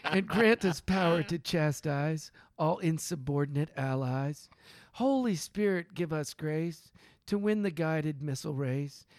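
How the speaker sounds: measured prayer recital, phrase by phrase with pauses; mock prayer reading. Man reading a satirical war prayer to friends, solemn recital. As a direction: intone it like grace from a pulpit. Reads a prayer poem aloud.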